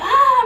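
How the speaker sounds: short, high, loud scream; re-enacted scream. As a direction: cry out as if for rescue. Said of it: "I'm screaming, like".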